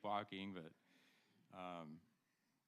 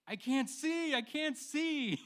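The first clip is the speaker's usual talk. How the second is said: high, loud panicked cry; imitated screaming; 9 dB louder, much slower than usual. Dad retelling his son's night-time panic at scout camp, screaming. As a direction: mimic a frightened kid screaming in the night. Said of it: "and I hear screaming".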